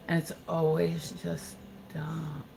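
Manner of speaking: slow, soft and drawn out; hushed, dragging exasperation; weary disbelief. Real, not acted. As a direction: let it sink, word by word, quietly. Weary verdict on trolls' comments.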